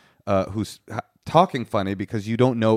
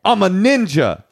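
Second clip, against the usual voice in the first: much higher, louder, slow put-on accent; attempted Afrikaans accent; 9 semitones higher, 6 dB louder, slower than usual. Answering the accent challenge, a hammed-up Afrikaans voice. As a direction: ham up a South African voice, proud of it. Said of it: "I can do a good one".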